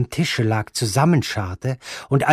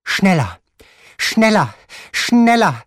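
from firm to shouted, climbing higher each time; commanding, building; 9 semitones higher, 6 dB louder than usual. Acted. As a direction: crack the whip harder on every repeat. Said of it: "kommandierte die Großmutter".

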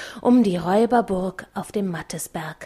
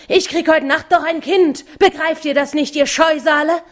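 higher, louder furious yell; angry yell; 7 semitones higher, 5 dB louder than usual. A narrator's reading. Mattes yells at the harpies that his child is coming, higher and louder. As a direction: rage at the shrieking harpies. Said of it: "brüllte er".

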